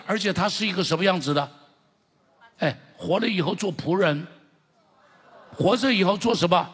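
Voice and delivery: loud, climbing call of praise; preaching at full force. Acted. Preacher rousing the congregation, loud and building. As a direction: drive each phrase home to the crowd.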